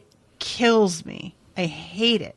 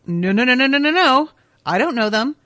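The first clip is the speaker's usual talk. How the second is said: louder, rising panicked no no no; flipped-out denial; 5 semitones higher, 5 dB louder, faster than usual. Retold or imitated. Retelling meeting someone familiar, a louder panicked denial. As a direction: push it away in a rush of alarm. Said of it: "and being like".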